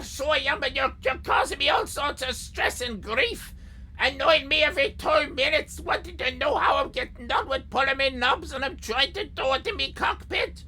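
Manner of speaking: a fed-up pilot yelling from the cockpit; mock exasperated rant. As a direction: blow up at the pest, hammed-up. Pilot character ranting at interrupter.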